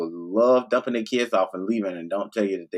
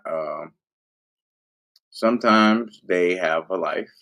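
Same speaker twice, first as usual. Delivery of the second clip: usual speaking voice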